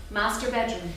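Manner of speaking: usual speaking voice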